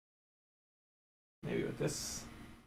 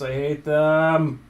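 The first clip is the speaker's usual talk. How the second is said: frustrated; 3 semitones higher, 7 dB louder, slower than usual. Real, not acted. Frustrated at C-clip.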